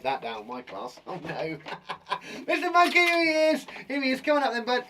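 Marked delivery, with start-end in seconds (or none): 2.5-4.8 high, loud greeting to a little pal; high-pitched, delighted calling out; excited calling. Pretend. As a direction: coax a puppet out with excitement. Calling puppet Mister Monkey out.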